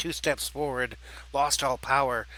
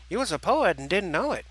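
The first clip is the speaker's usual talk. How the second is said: high sing-song tease, swooping pitch; teasing with a smile; 5 semitones higher than usual. Acted. Turkey teasing his brother over a rhyme, playful. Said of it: "Jerky exclaimed with a smile".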